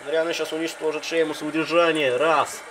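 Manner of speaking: high, loud hype commentary; excited call. Real, not acted. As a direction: call the slam and count it hyped. Choke slam and pin count.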